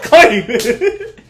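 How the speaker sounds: loud, high laughing cry; laughing shout. Real, not acted. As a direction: belt it out while cracking up. Laughing shout, echoing claim.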